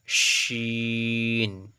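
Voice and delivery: শিন with a full "sh" and long "ee"; thick "sh". Shown as a demonstration. Teacher models big sheen, শিন thick and drawn out. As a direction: curl the tongue back for a thick, held শিন. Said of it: "আওয়াজটা একটু মোটা হবে".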